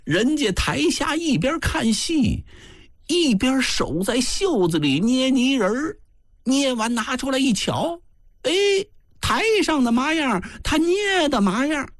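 thin, very high-pitched character voice; thin-voiced man. A narrator's reading. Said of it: "有个细嗓门的说".

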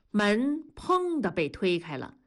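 usual speaking voice

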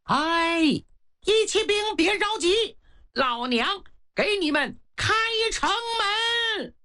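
high, ringing call from the wall; a tough older woman hollering from the ramparts; woman shouting down. Acted. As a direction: shout down to the riders below, bold and brash. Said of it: "这个女的冲着城下喊".

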